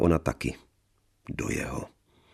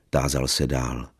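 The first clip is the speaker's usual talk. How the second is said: ordinary delivery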